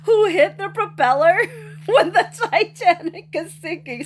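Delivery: high-pitched, laughing read-out; tickled by what you are reading; amused reading. Real, not acted. Reading a funny comment, amused.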